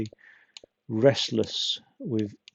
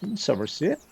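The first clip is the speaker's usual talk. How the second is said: mock Bristol accent; 6 semitones higher than usual. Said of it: "I've never really done a Bristolian accent".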